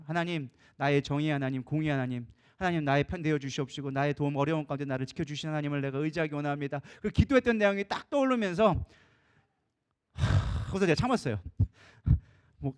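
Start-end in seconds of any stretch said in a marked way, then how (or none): 0.1-6.8 low, level, earnest praying voice; recalled prayer. Retold or imitated. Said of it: "기도했던 게 생각이 난 거예요". 10.1-10.7 long unvoiced-sounding exhale; sigh. Holding back anger, exhales.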